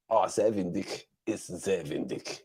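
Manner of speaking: put-on a light German accent on the German words; mild put-on German accent. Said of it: "In the accent as well".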